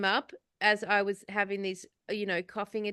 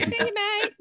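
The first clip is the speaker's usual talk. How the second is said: much higher, hammed-up Australian farewell; broad Aussie accent; 11 semitones higher than usual. Calling after the dog in an exaggerated, much higher Australian accent. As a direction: squeal it like a caricature Aussie. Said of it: "So Aussie".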